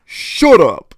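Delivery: loud, harsh shut up; mock angry snap. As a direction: snap it at the wife. Husband snaps at wife.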